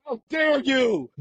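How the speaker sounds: high, slow, loud indignant shout; slow, high-pitched mock outrage; outraged how dare you. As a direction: call them out like a furious moderator. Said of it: "I'm in there like".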